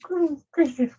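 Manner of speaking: very high, cooing puppy voice for the dog; voicing the dog. Owner voicing the puppy's wishes, high cooing baby talk. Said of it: "she said".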